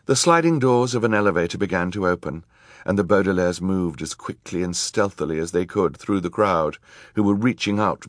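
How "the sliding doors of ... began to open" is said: ordinary delivery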